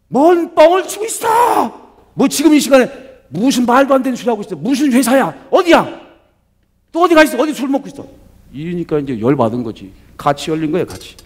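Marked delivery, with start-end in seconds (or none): 0.1-8.1 furious shout. Retold or imitated. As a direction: scream accusations at a husband who lies. Wife shouting at husband on phone. (남편에게 거짓말하지 말라며 악쓰는 아내, 분노의 고함)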